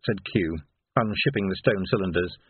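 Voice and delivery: usual speaking voice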